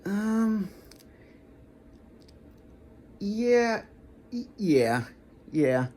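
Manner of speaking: high, hesitant, unsure yeah; weak yes. Shown as a demonstration. Answering a divination question with an unsure high yeah. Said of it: "this is how the yes would sound".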